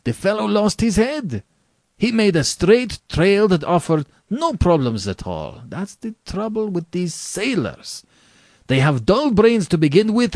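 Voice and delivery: high, animated general scorning his quarry; exasperated aristocratic general. Acted. General complaining to his guest about last night's hunting, exasperated.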